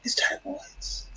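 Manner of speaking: hushed golf announcer; golf commentator whisper. Pretend. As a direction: murmur it like courtside on the green. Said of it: "I'm whispering like a golf reporter".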